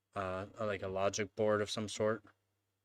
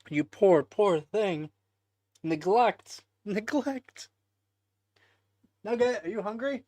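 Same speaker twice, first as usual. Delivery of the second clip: talking to a pet; 12 semitones higher, 7 dB louder than usual. Real, not acted. Cooing to her cat Nugget.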